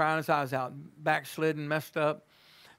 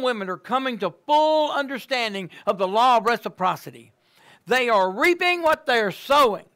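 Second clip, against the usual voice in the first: a fervent prayer climbing louder; prayer building to preaching; 7 semitones higher, 7 dB louder than usual. Acted. Pastor praying over the room, rising to full-force preaching.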